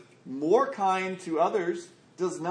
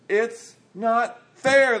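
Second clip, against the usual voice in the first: louder, higher, dragged-out complaint; mock indignant whine; 5 semitones higher, 6 dB louder, much slower than usual. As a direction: stretch out a grumbling protest, all mock outrage. Workers grumbling at master.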